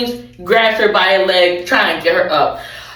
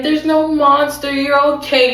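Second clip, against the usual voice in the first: singsong, higher soothing mom voice; consoling mother; 7 semitones higher than usual. Acted. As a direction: soothe a frightened child. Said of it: "being a mother consoling".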